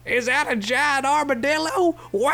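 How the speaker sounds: big booming Southern drawl; Southern cowboy voice. Retold or imitated. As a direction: ham it up like a Texas cowboy. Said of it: "In a giant, like, like, Southern cowboy voice".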